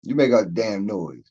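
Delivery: normal talking voice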